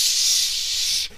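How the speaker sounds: a long unvoiced spray hiss. Imitating a spray can while telling friends about crickets, hissing. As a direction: hiss like a can of Raid being emptied.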